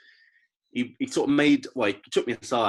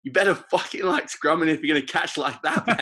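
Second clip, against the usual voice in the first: higher-pitched mock telling-off with a laugh; mock angry coach; 6 semitones higher, 4 dB louder than usual. Coach to Hilly about his catching, mock angry. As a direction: imitate a coach snapping, amused underneath. Coach turns on player, punchline.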